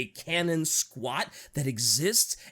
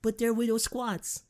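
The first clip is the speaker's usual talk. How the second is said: higher, cutesy little-one voice; mock baby talk; 5 semitones higher than usual. Cooing as tiny squat babies.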